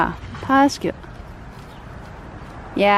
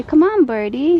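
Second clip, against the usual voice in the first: coaxing a wild bird; 5 semitones higher, 7 dB louder than usual. Shown as a demonstration.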